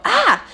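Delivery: short, high startled "ah"; a sudden yelp held back for radio; toned-down demo yell. Shown as a demonstration. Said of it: "I would give a surprisingly loud yell".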